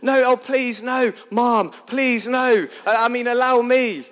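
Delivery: mortified pleading. Retold or imitated. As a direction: beg her to stop, embarrassed. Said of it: "you'd be mortified saying".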